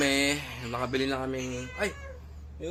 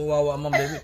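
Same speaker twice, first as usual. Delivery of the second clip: usual speaking voice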